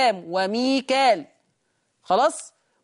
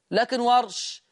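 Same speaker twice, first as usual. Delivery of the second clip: normal talking voice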